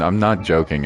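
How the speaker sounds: ordinary talk, nothing marked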